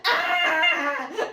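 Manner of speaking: loud, high-pitched freak-out shriek; piercing "ah!" of dread; panicked scream. Retold or imitated. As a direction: let out a short scream of mock panic. Texting friends in panic before hard talks.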